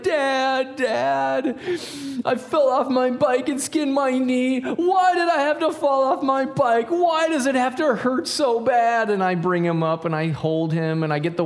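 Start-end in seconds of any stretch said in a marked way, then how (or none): 0.0-4.6 put-on high, drawn-out wail of a hurt boy; mock crying child. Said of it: "and he's crying". 4.6-9.1 put-on high, slow whine of a hurt kid; mock crying child. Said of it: "and he's crying".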